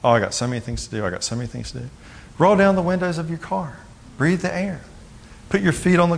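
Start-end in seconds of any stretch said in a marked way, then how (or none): 0.0-1.9 rapid run-on muttering to self, trailing softer; quick, low-key mock fretting; busy inner thoughts. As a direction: rattle it off like a crowded mind. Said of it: "up in our head thinking".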